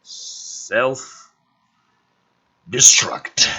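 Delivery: very slow drawn-out reveal; slow ominous reveal. Acted. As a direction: stretch it out for suspense. Slow dramatic translator reveal.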